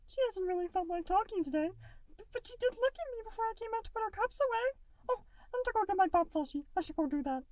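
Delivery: a tiny blob kid, sweet and distracted; little gelatinous Goo. A narrator's reading. Little Goo putting off dinner, high and bright. Goo's voice.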